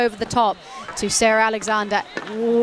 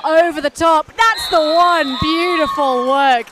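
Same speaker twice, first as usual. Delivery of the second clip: higher and louder, stretched out over the goal; excited goal call; 6 semitones higher, 4 dB louder than usual. Real, not acted. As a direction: lift the voice as the goal goes in. Goal scored, commentator erupts.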